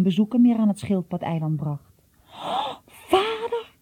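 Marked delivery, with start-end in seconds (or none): 2.3-3.8 high, breathy cry of alarm; sudden dismayed cry. Acted. Jom suddenly remembering his father, crying out in dismay. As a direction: blurt it out as it hits you.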